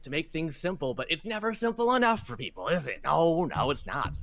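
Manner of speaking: exasperated captain rant; grumpy captain. Acted. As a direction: vent like a fed-up ferryman.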